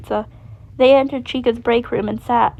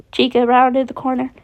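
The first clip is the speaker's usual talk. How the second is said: ordinary delivery, nothing marked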